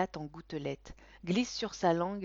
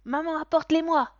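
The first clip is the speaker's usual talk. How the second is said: put-on high, loud demanding child; a boy who wants his presents now; excited boy; 10 semitones higher, 11 dB louder than usual. A narrator's reading. (enfant excité et exigeant, aigu)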